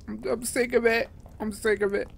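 fed-up whine. Real, not acted. Fed up after dying.

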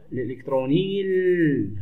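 الإلكتروني ال run into a long held L; no break before the final ال, just a sustained L; silent article hamza. Shown as a demonstration. Teacher treating the hamza as absent, الإلكتروني ال linked smoothly. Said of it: "هنا لا ننطقها كأنها غير موجودة".

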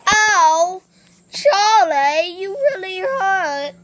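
high, loud mock cry; Charlie bit my finger. Brother replays the viral toddler with mock crying. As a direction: whine it out like the hurt toddler.

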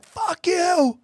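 high, yelled insult; imitating a heckler yelling; mock shout. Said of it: "They're like".

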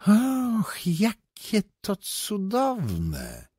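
high, loud and very slow cry of wonder; delighted exclaiming. A narrator's reading. Foreigner to the two atheists, exclaiming in rapture. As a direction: drink in the news with theatrical delight. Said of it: "wykrzyknął zdumiewający cudzoziemiec".

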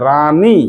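রানী with a long "raa", then a drawn "nee". Shown as a demonstration. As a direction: split রানী into two stretched syllables. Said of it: "আকার যেমন আছে".

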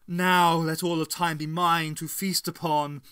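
grand, gloating exultation; exultant. A narrator's reading. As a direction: proclaim victory over all time. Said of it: "exulted the Matriarch".

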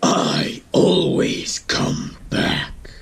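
slow, breathy threat; ominous return; slow menace. Acted. Slow villain line.

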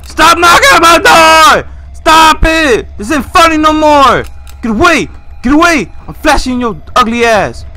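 high, loud frantic yelling; terrified furious shouting; panicked yelling. Real, not acted. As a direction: yell at the monster to go away. Fighting off scary knocking.

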